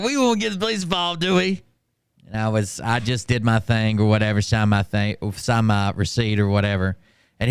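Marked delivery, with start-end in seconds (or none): 0.0-1.6 mocking manager. Mocking manager through laugh.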